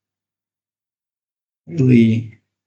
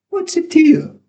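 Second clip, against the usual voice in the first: defiant retort; 10 semitones higher than usual. Acted. Pupil snaps back.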